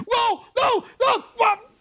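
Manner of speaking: high shocked yelps of disbelief; shocked yells. Real, not acted. Mock commentator watching the giant fall, shocked high yells.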